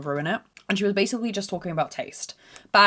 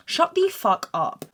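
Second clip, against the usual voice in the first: slow "Shut the fuck up", pitched much higher; emphatically articulated; 9 semitones higher, slower than usual. Retold or imitated. Daughter repeating it to mum, high and over-articulated. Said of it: "I'm articulating perfectly".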